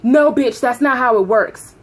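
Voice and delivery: mock supervisor snapping loudly; loud angry refusal.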